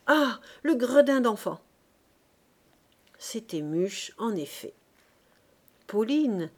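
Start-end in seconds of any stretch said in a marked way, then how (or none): 0.1-1.6 loud, higher burst of indignation; exasperated cry. A narrator's reading. Mother cursing the street urchin, exclaiming. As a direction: exclaim it crossly and loudly. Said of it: "s'écria la charcutière".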